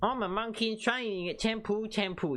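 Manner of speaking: put-on high-pitched English boy; little boy's English accent. Shown as a demonstration. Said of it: "Do something like that".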